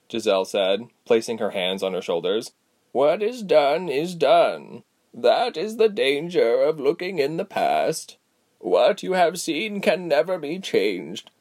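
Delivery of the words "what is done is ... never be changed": slow, measured elder's voice; slow wise old woman. Acted. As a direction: speak like a patient old sage. Giselle's slow voice.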